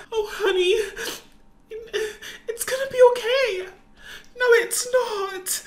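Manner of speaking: high, teary reassurance; crying mother consoling her child; tearful comfort. Acted. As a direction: hold back sobs to soothe her. Mother comforting dying daughter, crying.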